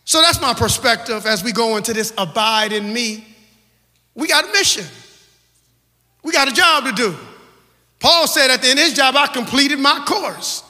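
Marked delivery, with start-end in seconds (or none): 4.1-10.6 higher, louder preacher's cry; rally them like a climax; full-force preaching. Real, not acted. Preacher lifting the church toward praise.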